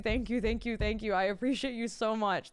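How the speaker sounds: ordinary talk, nothing marked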